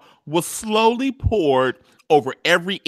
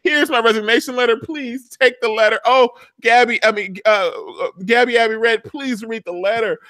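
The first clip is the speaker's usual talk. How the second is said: higher-pitched, hammed-up woe; mock lament; 5 semitones higher than usual. Recapper acting Olivia's staged resignation, mock-tragic lament. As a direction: fake a melodramatic confession, pitched up.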